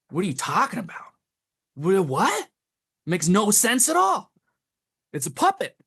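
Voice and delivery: exasperated, astonished comeback; incredulous. Retold or imitated. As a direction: push back in disbelief, pitch climbing. Retelling his baffled reply to accusers.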